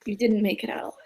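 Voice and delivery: quiet, whispered confession; soft, halting raspy whisper. Acted. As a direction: say it in a hushed rasp. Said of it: "voice is kinda raspy".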